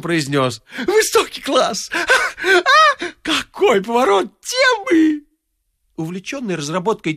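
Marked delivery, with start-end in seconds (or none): 0.9-5.2 high, slow laughing delight; laughing rapture. A narrator's reading. The wit charmed by sparrows, laughing high. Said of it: "заливаясь смехом".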